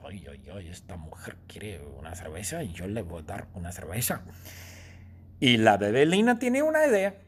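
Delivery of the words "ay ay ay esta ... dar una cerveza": soft, low, fast scheming mutter; inner thought. Acted. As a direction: plot quietly to yourself. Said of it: "la bebé piensa". (la bebé piensa en voz baja, tramposa)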